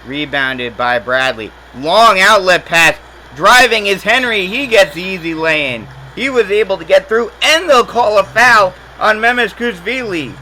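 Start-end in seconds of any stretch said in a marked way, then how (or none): 1.7-10.3 higher and louder as the break unfolds; excited fast-break call. Real, not acted. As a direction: ride the fast break up to the lay-in. Fast break in live commentary.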